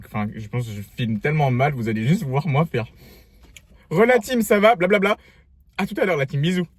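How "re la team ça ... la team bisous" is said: vlogger greeting voice. Pretend.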